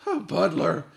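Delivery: roared. A narrator's reading. Captain roaring at the butler, loud outraged shout. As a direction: roar it in disbelief. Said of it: "the captain roared".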